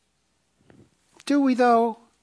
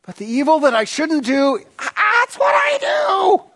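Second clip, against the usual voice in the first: anguished Paul; 9 semitones higher than usual. Retold or imitated. Paul cries out his struggle in Romans, anguished. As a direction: wail the confession, stretching the shout. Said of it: "Read Romans seven".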